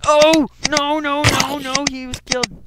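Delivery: high, loud anguished no, no; distressed shout. Real, not acted. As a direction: cry out in dismay. Friend killed by zombie.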